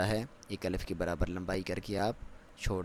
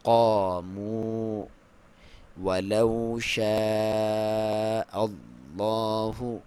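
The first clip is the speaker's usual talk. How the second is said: slow drawn-out tajweed chant; Quran recitation; 4 dB louder, much slower than usual. Shown as a demonstration. Teacher recites the verse for students, slow chant. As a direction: recite it slowly with full tajweed. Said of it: "جائلین".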